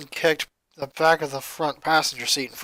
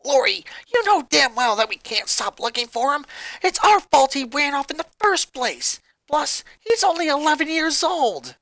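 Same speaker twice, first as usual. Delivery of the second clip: higher, pressing hard; angry bark; 9 semitones higher than usual. Acted. Luna angrily snaps at Lori over their brother. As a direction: bark it back at her. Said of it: "barked in anger".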